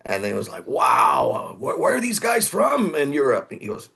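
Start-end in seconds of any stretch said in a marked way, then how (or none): 0.7-3.5 amazed. Retold or imitated. Label guys amazed by demo.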